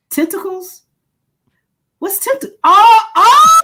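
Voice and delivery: high, rising into a loud startled oh; sudden realization. Retold or imitated. Speaker replays the moment she caught her testicles slip, high startled yelp. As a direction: catch the blunder mid-word and yelp.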